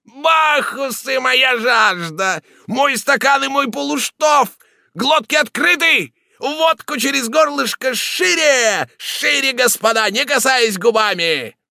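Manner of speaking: very high, loud, slow bellowing; shouting at the top of his lungs. A narrator's reading. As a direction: roar the toast. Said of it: "крича во всё горло".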